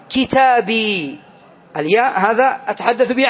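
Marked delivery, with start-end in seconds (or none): 0.1-1.2 كتابي drawn out slowly, each sound clear; slow example word. Shown as a demonstration. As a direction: lay out كتابي slowly for the class. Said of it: "أقول".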